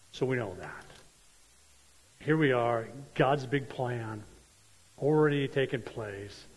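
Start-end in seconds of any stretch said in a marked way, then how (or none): none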